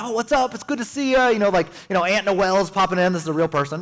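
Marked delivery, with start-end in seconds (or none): 0.0-1.3 high, chirpy family hello; relative greeting. Retold or imitated. Relative on a Christmas FaceTime call, high cheerful hello. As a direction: greet him brightly on the call.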